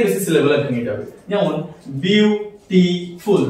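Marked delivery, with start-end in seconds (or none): 1.9-3.4 "বিউটিফুল" slowly split into three syllables. Shown as a demonstration. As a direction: break "বিউটিফুল" into three drawn-out beats, বিউ-টি-ফুল. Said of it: "তিনটে সিলেবলে আমরা ভাঙতে পারছি".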